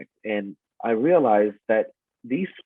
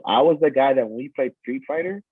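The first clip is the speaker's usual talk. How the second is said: normal talking voice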